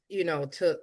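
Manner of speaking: ordinary talk, nothing marked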